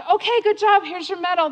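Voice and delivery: high, loud, brisk congratulations; upbeat race official. Retold or imitated. Race staff to finisher at the bar, high put-on congratulations. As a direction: hand it over with put-on cheer.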